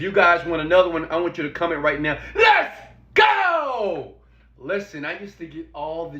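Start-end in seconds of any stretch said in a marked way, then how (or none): 2.3-4.1 hype call. Real, not acted. Hyping up the audience.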